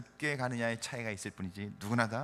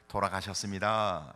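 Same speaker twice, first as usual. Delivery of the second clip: lower, plain Seoul Korean delivery; Seoul standard speech; 6 semitones lower than usual. Retold or imitated. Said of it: "서울 사람들은 어떻게 해요?".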